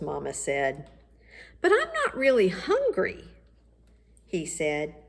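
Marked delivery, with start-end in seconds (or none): 1.6-3.3 put-on boy protesting. Michael refuses dinner.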